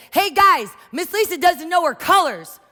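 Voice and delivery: high, loud tattling shout to the room; child shouting to classmates; kid yelling. Retold or imitated. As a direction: yell it out like a cheeky child announcing to everyone. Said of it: "he yelled to the air".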